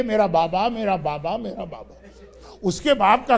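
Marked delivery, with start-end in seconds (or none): 0.0-1.4 softly calling father. Retold or imitated. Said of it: "بچی بار بار کہہ رہی ہے". 1.4-1.9 میرا بابا almost breathless, far softer, dying away; fading to a breath. Retold or imitated. Slave girl echoes the child calling for her father, fading away. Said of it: "بچی بار بار کہہ رہی ہے".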